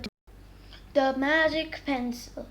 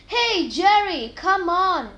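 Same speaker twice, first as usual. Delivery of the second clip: louder, higher, slow call; shouting; 6 semitones higher, 8 dB louder, slower than usual. A narrator's reading. Mike calling Jerry from outside, shouted. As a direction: call out to hurry him. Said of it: "shouted Mike".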